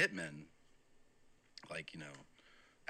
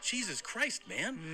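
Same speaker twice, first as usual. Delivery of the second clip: stunned exclamation; 10 semitones higher, slower than usual. Retold or imitated. Retold reaction to the driver.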